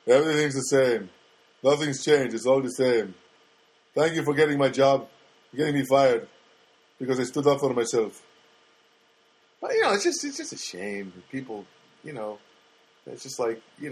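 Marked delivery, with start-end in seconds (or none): 0.1-8.2 lightly voiced co-host, reciting grievances; imitating Sam. Imagined co-host answers the host about losing his job, imitating him. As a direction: put on a co-host's voice, a touch louder. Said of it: "Sam, what do you think?".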